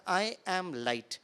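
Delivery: very slow, tender affirmation; meditative self-affirmation, very slow. Shown as a demonstration. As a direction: savour each word slowly. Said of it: "इस रहस्य को महसूस करना".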